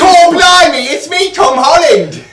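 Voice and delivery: very high, slow cockney-ish mock British; hammed-up cartoon Brit; British accent. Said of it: "how's your British accent is the thing".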